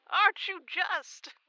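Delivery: high, slow and fond; sweet grandmotherly teasing; doting coo. Acted. Doting on the guest.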